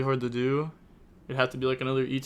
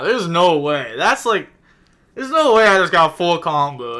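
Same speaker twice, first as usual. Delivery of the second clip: loud, incredulous outburst; can't believe what just happened; real loud disbelief; stunned disbelief; 3 semitones higher, 8 dB louder than usual. Real, not acted. Stunned by getting full combo.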